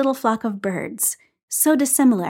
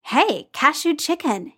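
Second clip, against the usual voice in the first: Clint's voice; 7 semitones higher than usual. Acted. Clint voiced in read story.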